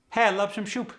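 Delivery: Khmer-accented, S turned to SH. Shown as a demonstration. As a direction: say "soup" as shoup, high Khmer accent. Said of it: "Sometimes the S becomes an S H".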